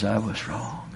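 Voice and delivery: soft apology. Retold or imitated. Quoting pastor's apology, moved.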